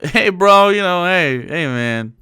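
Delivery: loud, easy bro hello; casual friend talk; casual bro greeting. Retold or imitated. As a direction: greet like a buddy. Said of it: "where I'll be like".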